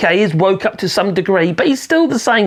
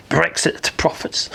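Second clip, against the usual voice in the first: whispered scorn; 5 semitones lower, 7 dB quieter, slower than usual. Real, not acted. Scornful quiet jab.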